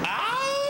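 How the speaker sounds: a sharp falsetto ow yelp; party yelp. Acted. Dude yelps at the party, a shrill high ow.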